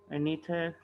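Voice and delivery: usual speaking voice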